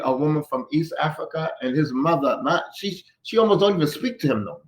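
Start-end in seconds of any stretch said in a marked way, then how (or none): none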